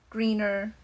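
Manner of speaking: ordinary talk, nothing marked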